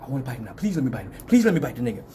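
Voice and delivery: low, hushed eager dog voice; pleading dog. Retold or imitated. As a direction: beg under your breath as the dog. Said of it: "He like".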